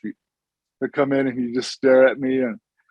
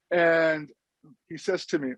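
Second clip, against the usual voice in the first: ordinary delivery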